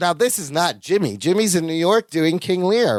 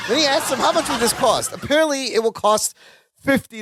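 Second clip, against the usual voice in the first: laughing; 7 semitones higher than usual. Real, not acted. Laughing while retelling.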